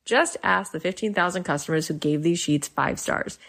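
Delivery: upbeat sponsor read. Acted. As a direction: read it like a podcast ad. Host reads sponsor ads.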